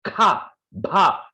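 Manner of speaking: kha and bha pushed out with breath; emphasized aspirates. Shown as a demonstration. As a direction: give kha, bha a strong puff of air. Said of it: "I'm always emphasizing those".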